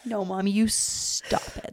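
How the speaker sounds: put-on soft, slow little-boy scolding; quiet toddler telling mom to stop; imitating toddler. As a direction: mimic his tiny bossy voice. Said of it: "That's his new thing".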